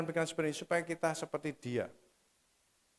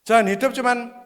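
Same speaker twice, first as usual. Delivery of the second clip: preaching at full force; 3 semitones higher, 12 dB louder than usual. Acted. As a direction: preach it hard, hammering each bukan. Khotbah penuh semangat. (khotbah berapi-api dengan tekanan keras)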